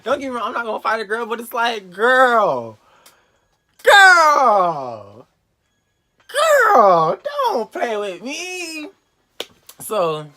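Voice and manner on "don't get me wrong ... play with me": high, loud, hammed-up outrage; theatrical high-pitched warning, words stretched; indignant outburst. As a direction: act out disbelief, building to long wailed "girl"s. Replays outrage at the girl's boast.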